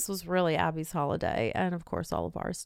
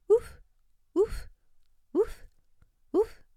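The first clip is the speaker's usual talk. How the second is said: dog yelping; slower than usual. Retold or imitated. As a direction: yip like a small dog. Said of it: "my dog would start yelping".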